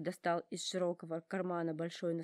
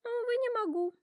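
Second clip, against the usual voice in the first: much higher, louder sorry giraffe; 6 dB louder, faster than usual. A narrator's reading. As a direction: apologize in a high giraffe voice. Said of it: "говорит Жирафа".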